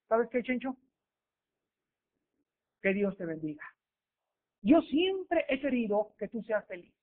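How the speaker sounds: soft, gentle blessing; tender farewell. Acted. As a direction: speak softly with forgiving love. Loving girlfriend blesses boyfriend.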